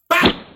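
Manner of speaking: a loud, high shouted "Bam!" for the slap; slap sound effect. Retold or imitated. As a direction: punch it out like the smack landing. Sound of grandma's backhand slap.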